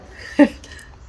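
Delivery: short breathy laugh; a real laugh after the quote. Real, not acted. Host laughs after quoting the doctor.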